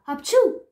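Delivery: loud, high achoo; explosive interrupting sneeze; acted sneeze. A narrator's reading. The allergic king sneezes. (strănut exploziv)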